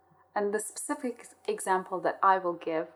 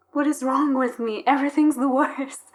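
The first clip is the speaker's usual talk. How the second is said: higher, louder mock inner panic; 5 semitones higher, 6 dB louder than usual. Guest mocks her own panicked thoughts in public, higher and louder. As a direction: play out the spiral of frantic thoughts.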